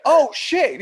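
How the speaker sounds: high, loud mock astonishment; imitated amazement. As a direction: play the doctor suddenly impressed. Acts doctor's amazed reaction.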